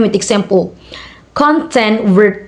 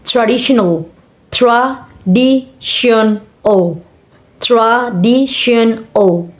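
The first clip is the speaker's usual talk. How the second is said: syllable by syllable. Shown as a demonstration. Teacher showing four syllable word, syllable by syllable. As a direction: pause between tra, di, tion, al. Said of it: "four syllable words".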